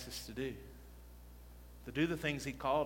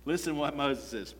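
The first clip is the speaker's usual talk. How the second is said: ordinary delivery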